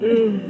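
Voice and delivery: loud, high ooh of wonder; excited ooh. Shown as a demonstration. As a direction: demonstrate a big impressed ooh. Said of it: "Should we practice that noise?".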